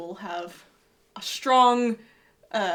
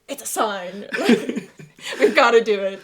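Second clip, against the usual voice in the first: laughing; 8 dB louder than usual. Retold or imitated. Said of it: "I was like".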